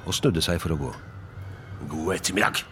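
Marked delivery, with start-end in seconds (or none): 1.9-2.6 gruff, curt dismissal; grumbled. Acted. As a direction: grumble a goodbye to be rid of him. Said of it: "brummet Scrooge". (gretten brumming)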